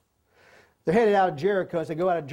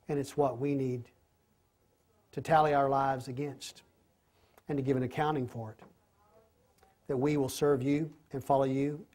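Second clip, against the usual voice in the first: soft, low prayer closing the study; 3 semitones lower, 3 dB quieter than usual. Acted. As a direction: pray quietly and earnestly over the group.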